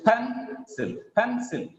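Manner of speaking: "pencil" as a slow, high PEN, then a falling -cil; PEN... sil, carefully modelled; stress on first syllable. Shown as a demonstration. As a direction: stretch and lift the PEN of "pencil". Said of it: "The stress is on first syllable".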